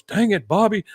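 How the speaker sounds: a hint of a grumbling dad; mildly annoyed Texan dad; Hank impression. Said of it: "he goes".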